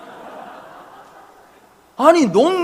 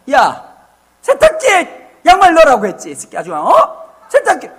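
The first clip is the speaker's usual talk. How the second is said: exasperated scolding, louder and higher; nagging yell; 5 semitones higher, 5 dB louder than usual. Retold or imitated. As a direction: snap at them over the socks again. Nagging about socks.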